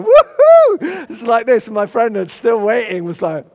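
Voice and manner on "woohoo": very high, loud whoop; exultant "woohoo". Retold or imitated. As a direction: let out a huge joyful whoop. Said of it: "I came out of that room going".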